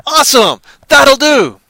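high, loud cartoonish cheer; mock delight. Parody Jesus thrilled at Judas's fish, high and loud. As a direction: cheer like a hammed-up hero.

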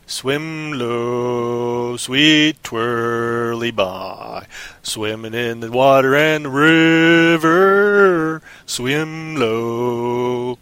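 very slow, low crooning creature voice; alligator voice. Acted. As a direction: croon it like a lurking gator. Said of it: "You know what the alligator told him?".

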